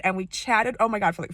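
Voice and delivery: ordinary talk, nothing marked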